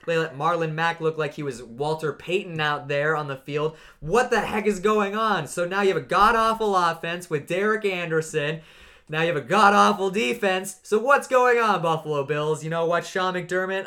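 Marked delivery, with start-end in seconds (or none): 4.0-13.8 loud, higher, exasperated ranting; loud indignant rant at Buffalo's coach; fed-up rant. Real, not acted. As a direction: vent straight at the team. Ranting at the Bills.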